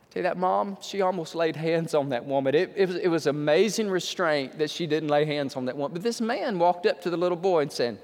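ordinary talk, nothing marked

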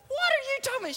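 very high indignant squawk; incredulous protest. Acted. Johnny hears he must do her part, incredulous. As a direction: protest in high-pitched disbelief.

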